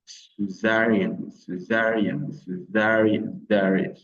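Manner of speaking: slow "césarienne", leaning hard on ZA; stress on ZA. Shown as a demonstration. Teacher drilling the accent for learners, "césarienne" slowly stressed on ZA. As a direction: draw out the stressed second syllable of "césarienne". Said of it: "Vous mettez l'accent sur".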